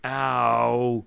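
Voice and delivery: loud comic yelp; hammed-up ow; mock scream. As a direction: cry out in pain, hammed. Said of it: "he screamed".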